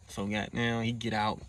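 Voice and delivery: usual speaking voice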